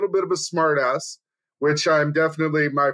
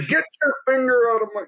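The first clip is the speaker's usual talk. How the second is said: fast, higher mock protest; mock outraged yell; 7 semitones higher, faster than usual. Reacting to a partner's prank, quick mock outrage. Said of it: "I'd be like".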